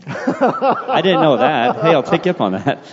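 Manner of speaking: real amused laugh through the words; delighted laughing reply. Real, not acted. Laughs at offer of counseling.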